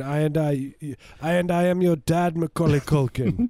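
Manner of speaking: put-on high, loud Rastafarian accent; rasta speech. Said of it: "a Rastafarian accent the whole time".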